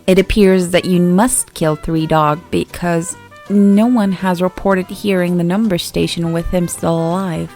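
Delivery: ordinary delivery, nothing marked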